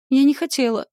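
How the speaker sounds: high, loud squeaked protest. A narrator's reading. Heroine stuck with the scythe, squeaking her excuse. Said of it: "пискнула".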